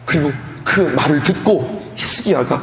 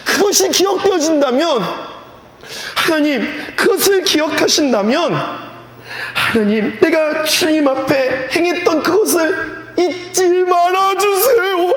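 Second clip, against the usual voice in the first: weeping prayer; 12 semitones higher than usual. Acted. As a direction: cry out to God like a dying king begging. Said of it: "심히 통곡하는데 말이 뭐냐면".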